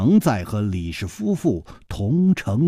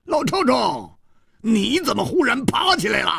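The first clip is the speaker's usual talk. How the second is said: 胡铁花的高声惊问; 11 semitones higher than usual. A narrator's reading. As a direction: blurt it out high and startled. 胡铁花惊问楚留香. (高声惊叫)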